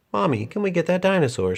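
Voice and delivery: put-on high, quick childlike plea; bright little girl voice; little girl asking. A narrator's reading. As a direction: ask Mommy eagerly. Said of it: "she asked".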